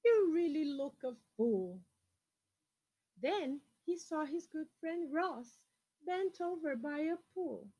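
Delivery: put-on lively picture-book reading to children; storyteller voice for kids; story reading. A narrator's reading. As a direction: read it out to the class with expression. Reads a picture book aloud.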